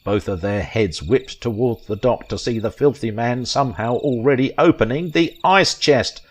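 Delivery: plain reading voice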